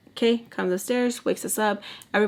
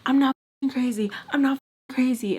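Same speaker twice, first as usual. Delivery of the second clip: set off; 5 semitones higher than usual. Retold or imitated. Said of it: "That sets her off".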